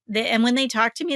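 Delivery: usual speaking voice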